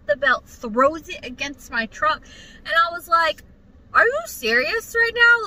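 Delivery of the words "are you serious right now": high, loud disbelieving retort; retold outraged question, pitched way up; incredulous. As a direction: fire back in indignant disbelief. Retold argument with coworker.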